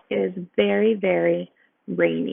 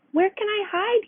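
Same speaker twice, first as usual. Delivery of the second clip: high, light ant voice; a small worried ant asking; ant wondering aloud; 12 semitones higher than usual. A narrator's reading. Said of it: "he wondered".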